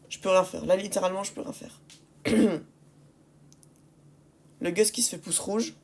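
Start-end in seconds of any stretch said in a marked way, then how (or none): 1.2-1.8 unvoiced defeated whisper. Real, not acted. Repeated helpless phrase.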